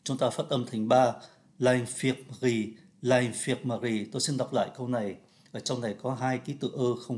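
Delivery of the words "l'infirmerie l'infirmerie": "l'infirmerie" with the mute e dropped, three syllables. Shown as a demonstration. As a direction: say "l'infirmerie" as three beats, no schwa. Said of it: "Thay vì l'infirmerie một, hai, ba, bốn".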